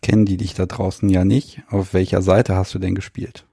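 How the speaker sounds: ordinary talk, nothing marked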